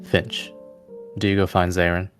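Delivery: ordinary talk, nothing marked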